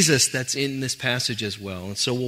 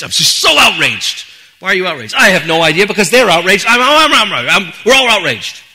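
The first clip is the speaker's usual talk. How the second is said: loud, higher hammed-up outrage; mock outrage; 6 semitones higher, 13 dB louder than usual. Preacher mocks people always looking to be outraged, loud mock shouting. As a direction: shout it like a crowd angry at nothing.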